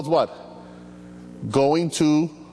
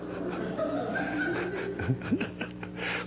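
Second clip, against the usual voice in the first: laugh; 12 dB quieter than usual. Real, not acted. Pastor laughs at own list.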